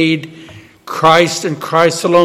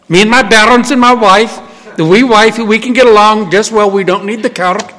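lightly voiced Scottish farmer; a hint of Scottish brogue; 4 semitones higher, 4 dB louder than usual. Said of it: "I can do a good Scottish brogue".

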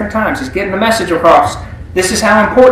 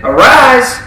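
a higher, louder call to rise; commanding; 6 semitones higher, 5 dB louder than usual. Acted. Jesus commanding the girl to rise from death, loud and high. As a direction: ring it out like a command. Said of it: "he commanded".

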